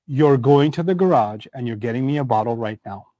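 low, certain order stated as fact; firm parental authority, pitched low; authoritative statement. Shown as a demonstration. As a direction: lay down the order as settled fact. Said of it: "You speak with such authority".

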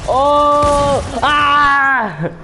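sudden high loud cry of shock; startled scream. Real, not acted. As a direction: jump out of your skin.

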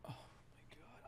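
whispering. Real, not acted. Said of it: "I'm gonna need to repent".

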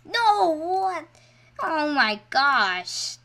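loud, high outcry sinking into a drawn-out groan; shocked dismay. Real, not acted. Gamer reacts to failing after trying, shocked and dismayed. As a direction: cry out at a sudden loss. Player suddenly fails in game.

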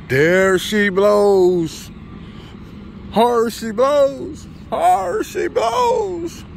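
slow, high, stretched call, rising to a shout; drawn-out hammed-up call. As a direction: crow it out like an old sailor. Sounding off as truck leaves.

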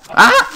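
sudden high, loud shriek of fright; startled scream. Real, not acted. Ambushed by angry chickens in the cave, a startled scream. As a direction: scream as something leaps out. Jump scare by chicken mob.

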